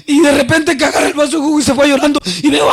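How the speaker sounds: usual speaking voice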